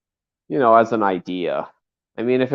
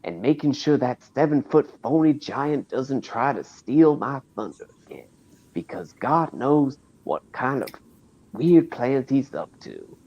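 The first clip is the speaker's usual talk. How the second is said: Tiny Tug; 4 semitones higher than usual. Acted. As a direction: play a folksy Southern character addressing the fans. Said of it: "Here is Tiny Tug".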